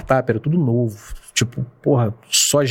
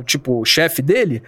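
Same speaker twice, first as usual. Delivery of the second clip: ordinary talk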